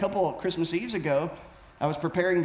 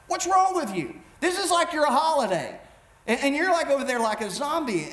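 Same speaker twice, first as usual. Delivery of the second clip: higher, insistent mock-mom scolding; playing his wife getting on his case, pitched up; imitating a nagging wife; 6 semitones higher than usual. Wife calling from the kitchen about his zombie mood, mock scolding. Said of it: "she kinda mamaed me there".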